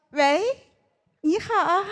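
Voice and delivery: high cutesy phone greeting; cutesy sweet voice. Retold or imitated. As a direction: sweeten it like a cartoon princess. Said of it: "你要用夹子音".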